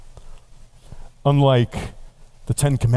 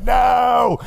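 stubborn shout; 9 semitones higher than usual. Acted. Believer being dragged by God, refusing with a shout.

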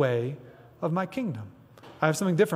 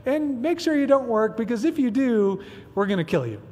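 mock cheerful threat; 7 semitones higher than usual. Preacher mocks a cheery law that threatens death, sing-song. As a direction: deliver the threat in a bright, breezy tone. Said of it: "and, like".

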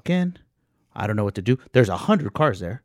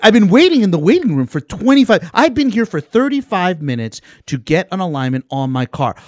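higher, louder fed-up customer; exasperated complaint; 8 semitones higher, 8 dB louder than usual. Retold or imitated. Customer complaining to staff after a long wait, loud and exasperated. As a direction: vent it, rising frustration.

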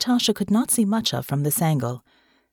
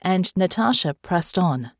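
ordinary delivery, nothing marked

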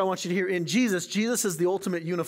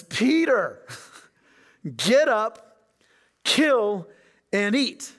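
louder, higher final command with a laugh breaking in; the Lord's third command, insistent and raised; 4 semitones higher, 5 dB louder, much slower than usual. Acted. Lord commands the third time.

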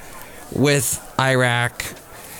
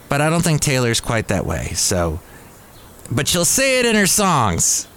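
normal talking voice

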